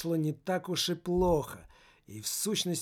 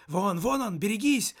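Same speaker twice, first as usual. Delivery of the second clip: higher mock shout of warning; 5 semitones higher, 3 dB louder than usual. The soul warns the teenager of the attacker, higher. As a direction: cry out a warning, lightly. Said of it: "закричал он".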